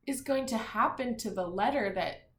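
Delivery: ordinary talk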